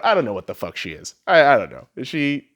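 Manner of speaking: ordinary talk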